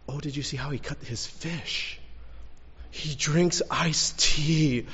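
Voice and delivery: hushed, drawn-out gushing of a starstruck onlooker; awed fan. Retold or imitated. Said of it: "like, excited".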